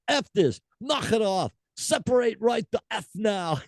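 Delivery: high mock Scottish scolding; furious singer. Host reads singer ordering girls to stop, high mock scold. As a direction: tell the crowd off in a high put-on voice. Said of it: "she just kept going".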